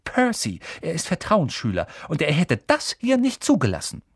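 high, reproving voice; pointed, tart. A narrator's reading. Hermine invokes the prefect brother to stop them, pointed. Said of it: "sagte Hermine spitz".

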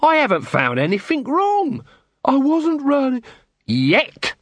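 high, wheedling accusing patter; Nobby's voice. Acted. Nobby bluffing the quartermaster with a high accusing patter. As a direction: lay the accusation on thick, pitched high.

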